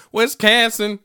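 "Wisconsin" high and loud, first syllable as "wes", second as "can". Retold or imitated. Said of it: "that fucking accent".

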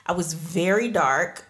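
ordinary talk

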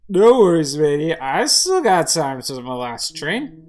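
put-on loud, slurry drunken assurance, slowing down; drunk man. Drunk customer reassuring the cart owner, loud and tipsy. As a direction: brush it off like a merry drunk. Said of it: "the drunk man walks up to it".

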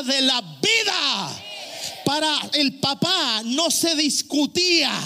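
slow, stretched-out pulpit proclamation; impassioned sermon cry, words drawn out; forceful preaching. Acted. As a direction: build to a shouted climax.

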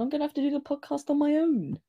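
high-pitched playful complaint; mock protest. Co-host threatened with going solo, mock protest.

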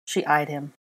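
usual speaking voice

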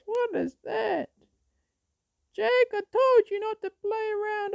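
high falsetto scolding mother; shrill mom telling off her son. Retold or imitated. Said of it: "And she says".

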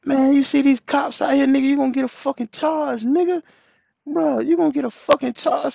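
high-pitched retold plea to a friend; urgent warning. Retold warning with cops nearby.

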